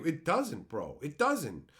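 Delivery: usual speaking voice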